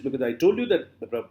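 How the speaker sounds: ordinary talk, nothing marked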